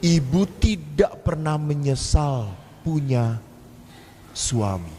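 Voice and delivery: ordinary delivery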